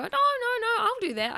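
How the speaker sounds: high-pitched polite protest; insistent polite guest. Retold or imitated. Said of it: "someone will go".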